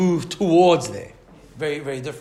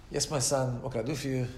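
softer, patient and calm; reply gently, no irritation; 4 semitones lower, 7 dB quieter than usual. Retold or imitated. Hillel answers the man again, soft and patient. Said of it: "very patient".

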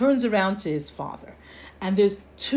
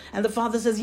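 ordinary talk, nothing marked

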